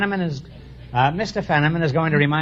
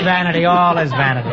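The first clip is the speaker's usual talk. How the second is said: usual speaking voice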